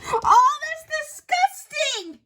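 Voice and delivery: high, loud cry of disgust; disgusted outburst. Acted. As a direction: recoil in horror at the mess. Rug soiled, outraged outburst.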